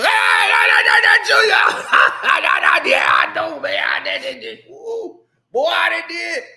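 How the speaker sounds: hollering homeboys reunion; loud reunion scream. Retold or imitated. Old homeboys meeting again, loud screaming. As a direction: scream and ramble like old friends. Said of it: "international loud scream".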